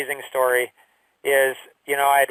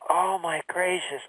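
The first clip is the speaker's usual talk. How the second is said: dawning realisation; 3 semitones higher, 6 dB quieter, slower than usual. Retold or imitated. Realisation hits him.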